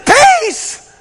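overwhelmed pastor yelling down the phone; screaming. Retold or imitated. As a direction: shout it, overwhelmed. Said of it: "I'm screaming at this poor lady".

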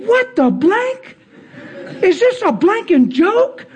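high, stretched-out incredulity; hammed-up flabbergasted reaction; imitated astonished swearing. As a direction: drop censored curses in stunned disbelief. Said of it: "he's just bam, bam. He's dropping bombs".

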